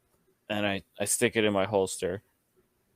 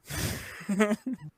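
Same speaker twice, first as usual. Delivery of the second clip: high laugh; 10 semitones higher, 5 dB quieter than usual. Real, not acted. Laughs at own chant.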